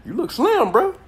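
hyped-up high mirror talk; talking to myself in the mirror. Retold or imitated. Talking to his reflection in the mirror, hyped high. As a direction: hype yourself up in the mirror, voice jumping up. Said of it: "I be like".

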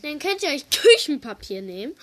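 speaking up clearly. Real, not acted. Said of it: "Du musst lauter sprechen".

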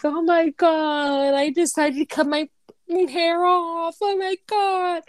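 high, hammed-up panic over the haircut; mock panic. Fake panicked call to mom.

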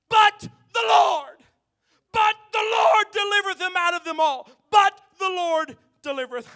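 preaching shout. Acted. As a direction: thunder the promise to the church.

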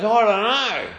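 high, loud mock wail; hammed-up anguished reply; imitated pained distress. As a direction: answer as if doubled up with cramps. Imitating man in cramps answering.

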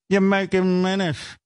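high, drawn-out put-on nag; hammed-up disapproving mom voice; scolding mother. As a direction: imitate mother stretching out the accusation. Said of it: "And she goes".

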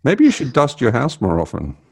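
ordinary talk, nothing marked

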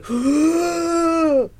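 long, high, loud awed gasp; a held in-breath of wonder; audience gasp. Retold or imitated. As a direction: gasp like a whole crowd in awe. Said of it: "you could feel the audience go".

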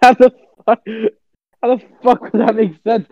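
ordinary talk

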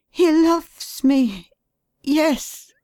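high, pleading voice of a distraught wife; grieving wife, high and pleading; wife's anguished voice. A narrator's reading.

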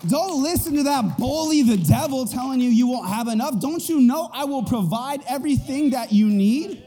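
high, emphatic divine reproof; God exclaiming. Acted. God to his fearful children, emphatic reproof. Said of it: "God is saying".

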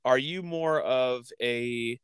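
ordinary talk, nothing marked

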